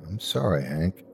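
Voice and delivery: soft, sorrowful apology; quiet sympathy. Acted. Chief breaks news of death.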